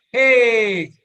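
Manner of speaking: loud high cheer; joyful cheer for the group; cheering hey. Shown as a demonstration. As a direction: shout hey like an excited child.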